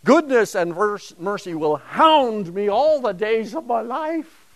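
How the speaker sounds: high, loud push on HOUND; emphatic HOUND. Shown as a demonstration. Lecturer contrasting the psalm with disasters, forceful and high. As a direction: declaim it with force, leaning on HOUND. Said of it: "That's what we're talking about".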